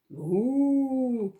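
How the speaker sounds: long loud mooing; sustained cow moo. Acted. Cow sound imitated.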